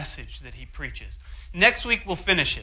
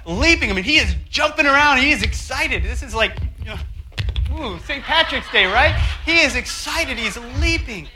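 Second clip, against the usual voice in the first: louder, higher, bursting with joy; excited; 7 semitones higher, 7 dB louder than usual. Real, not acted. Preacher picturing the healed man leaping, excited. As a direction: let the excitement spill over.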